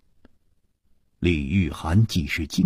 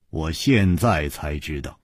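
ordinary delivery

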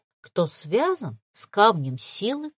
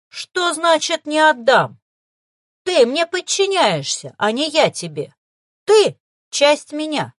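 commanding; 7 semitones higher, 6 dB louder than usual. A narrator's reading. Necromancer commands the darkness.

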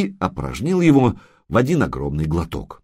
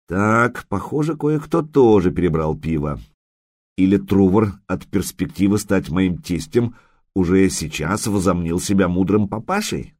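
usual speaking voice

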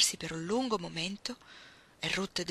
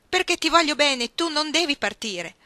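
loud, high, desperate protest; impassioned outburst; 8 semitones higher, 9 dB louder than usual. Acted. Iggy begging Ruth not to leave, impassioned outburst. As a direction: insist on it at the top of your voice.

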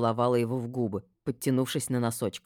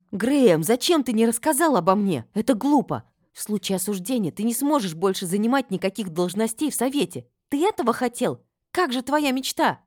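loud, high-pitched agitated reproach; upset reproach; 9 semitones higher, 5 dB louder than usual. A narrator's reading. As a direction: confront him urgently with raised voice. Heroine reproaches arrested friend. (взволнованный упрёк, громко и высоко)

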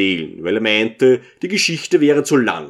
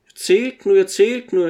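1 tongue in cheek; 7 semitones higher than usual. A narrator's reading. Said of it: "mit der Zunge gegen die Backe stoßend".

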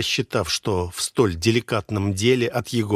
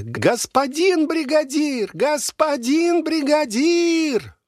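long, very high drawn-out yell; happy shout; 3 dB louder, slower than usual. A narrator's reading. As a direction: call across the yard joyfully. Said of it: "заорал счастливым голосом".

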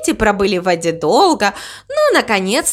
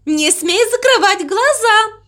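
high, insistent cow voice; excited insistence; 10 semitones higher than usual. Acted. Mama Mu insists the crow watch, high and insistent.

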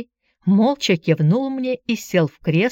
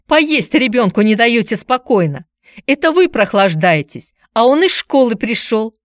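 raised, indignant voice; scolding shout; 5 semitones higher, 5 dB louder than usual. A narrator's reading. Aunt berates visitor for disturbing the boy's meal, shouting. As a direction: scold loudly on the child's behalf. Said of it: "закричала тётка".

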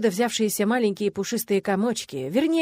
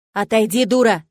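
loud scolding shout; angry yell; 3 semitones higher, 7 dB louder than usual. A narrator's reading. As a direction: snap it at her furiously. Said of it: "завопила демоница". (гневный окрик демоницы)

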